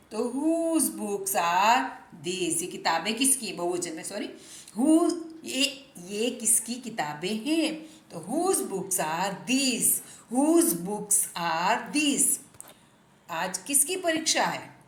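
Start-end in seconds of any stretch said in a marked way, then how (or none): 0.2-2.6 very slow, word by word; slow dictation-style read; slow model sentence. Shown as a demonstration. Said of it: "teacher models English sentence slowly". 8.2-12.4 very slow, word by word; slow dictation-style read; slow model sentence. Shown as a demonstration. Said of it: "teacher models English sentence slowly".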